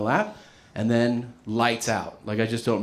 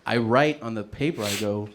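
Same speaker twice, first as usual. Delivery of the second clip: ordinary talk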